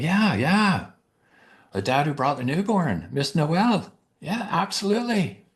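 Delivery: light up remembering him; eager recognition. Retold or imitated. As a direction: Santa gladly recalls the new dad. Eagerly recalling the father.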